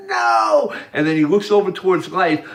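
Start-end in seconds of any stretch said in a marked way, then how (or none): 0.1-0.7 high, loud shouted NO. Retold or imitated. Hitler shouting no at the speaker. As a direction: yell it out in protest. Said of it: "Hitler's going".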